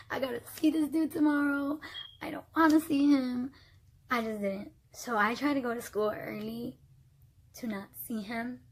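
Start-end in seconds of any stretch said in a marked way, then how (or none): 0.1-3.5 higher, louder mock-tearful whine; whiny dread. Dreading the ex at the shared locker, whiny. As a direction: complain as if about to cry.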